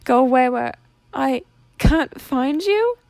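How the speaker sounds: high, loud and slow, voice cracking; tearful plea. Acted. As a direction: beg through tears, stretched out. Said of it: "choking back more sobs".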